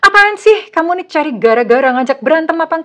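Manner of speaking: loud, high snapping retort; angry partner. Retold or imitated. Partner lashing out at a worried question, loud angry snap. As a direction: fire it back, offended and defensive. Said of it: "dia malah muring-muring, malah marah-marah".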